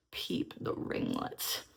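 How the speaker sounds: slow, breathless whisper. Real, not acted. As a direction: whisper it to yourself, proud. Repeats it softly to herself.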